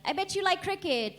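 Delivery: high, chirpy mock Brit; lightly hammed-up nosy Brit; mock British stranger. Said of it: "It's always something like".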